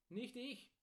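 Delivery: proud storybook smith. A narrator's reading. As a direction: answer with a touch of pride. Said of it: "Wieland, der Schmied, stolz".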